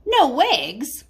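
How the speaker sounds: shocked, can't believe it; farmer's disbelief. Acted. Farmer Brown reading the cows' note, shocked disbelief.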